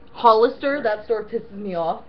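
normal talking voice

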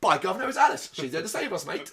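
hammed-up British accent; mock UK accent. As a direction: put on a cheeky British accent. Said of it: "do some form of the accents going".

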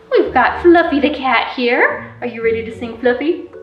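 ordinary talk

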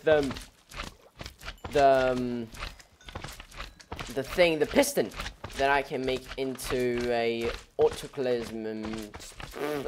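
ordinary delivery

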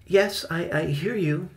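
put-on small, high, hesitant boy's voice; timid mutter. A narrator's reading. Benny answers Victoria's demand, timid high mutter. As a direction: answer haltingly, cowed. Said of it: "Benny muttered quietly".

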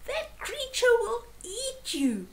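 very high, bird-like screech; alarmed bird's piercing cry; screeched warning. A narrator's reading. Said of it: "Then Bunderbird screeched".

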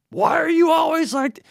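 hammed-up fed-up spouse yelling at the end of the trip; mock exasperated shout. Imagined end-of-trip marital quarrel.

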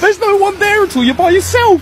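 high and loud mocking jeer; taunting. Prankster mocking a fake phone call, high jeering.

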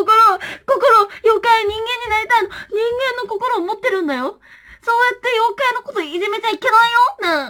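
high, loud mock-crying puppet voice; crying yokai plush. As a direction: play the hurt little yokai. (高い声で泣きそうな妖怪のぬいぐるみ)

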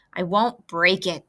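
snarl. A narrator's reading. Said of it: "snarled Templeton".

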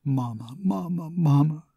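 from a whisper building to a high, loud cry; grief-stricken cry. A narrator's reading. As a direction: start whispered, break into a grieving cry. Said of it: "cried in a voice altered by grief".

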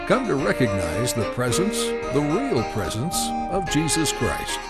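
ordinary delivery

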